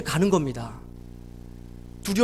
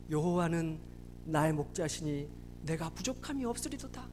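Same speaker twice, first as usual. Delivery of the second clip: low, soft recital; hushed psalm; 7 semitones lower, 10 dB quieter than usual. Acted. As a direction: voice young David's quiet trust in God. David's psalm to his only friend.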